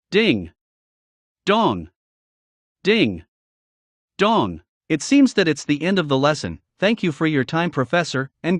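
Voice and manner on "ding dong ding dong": sung ding dong bell tones; bell chime imitation. A narrator's reading. School bell rings.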